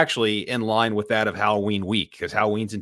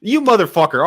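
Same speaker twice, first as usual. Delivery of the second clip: louder, higher playful curse; mock outrage; 8 semitones higher, 7 dB louder than usual. Real, not acted. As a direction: yell it in fake outrage. Friend steals his movie answer.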